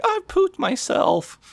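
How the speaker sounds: put-on very high, slow little-kid voice; imitated tot announcing it, drawn out and squeaky; small child. Small son answers parent, in high child voice.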